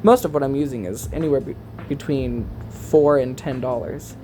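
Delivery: ordinary talk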